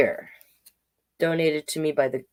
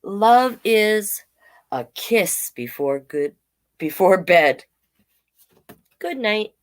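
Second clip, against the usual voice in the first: put-on reading to children; 4 dB louder than usual. Reads picture book to children.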